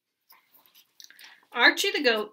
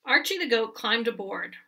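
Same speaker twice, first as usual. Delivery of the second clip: plain reading voice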